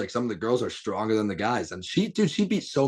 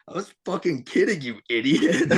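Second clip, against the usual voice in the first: higher, taunting mock voice; 7 semitones higher than usual. [name] to his brother after pinning him, mocking taunt. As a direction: needle him like a bratty kid.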